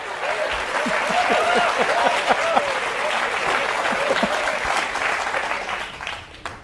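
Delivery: long high breathy laugh; wheezing laughter. Real, not acted. Laughing after the joke.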